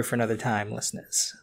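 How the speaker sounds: breathy stage aside; whispered aside. Real, not acted. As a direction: whisper it to the listeners. Aside to podcast audience.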